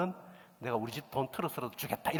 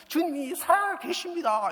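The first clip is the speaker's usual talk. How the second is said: high, loud joyful declaration; old pastor shouting praise down the phone; ecstatic shout; 9 dB louder than usual. Retold or imitated. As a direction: proclaim it as if overcome with joy. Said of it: "이러고 막 이러더라고요".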